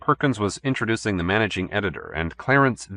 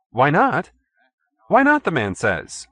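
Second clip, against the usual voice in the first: higher, exasperated repeat; mock echo; 7 semitones higher, 3 dB louder, slower than usual. A narrator's reading. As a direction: throw the words back with disbelief. Perkins mocking Pappy's echo.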